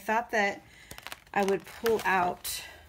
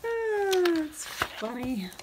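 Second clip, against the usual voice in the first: amused sigh; 9 semitones higher, much slower than usual. Real, not acted. Laughing at stuck page.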